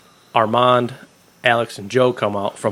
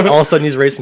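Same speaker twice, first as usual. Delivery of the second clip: ordinary talk, nothing marked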